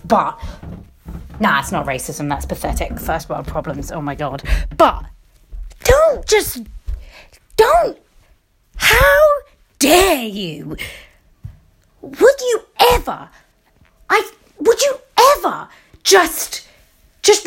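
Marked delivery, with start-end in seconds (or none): 4.8-10.8 outraged cry. Real, not acted. Telling mocking Americans off, outraged high cry. 12.0-17.5 outraged cry. Real, not acted. Telling mocking Americans off, outraged high cry.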